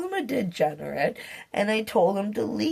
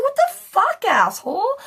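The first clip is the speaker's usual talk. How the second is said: much higher, louder retold yell; shocked, cursing shout, much higher; startled yell; 7 dB louder than usual. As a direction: yell it as if he just jumped out at you. Retelling being startled by him.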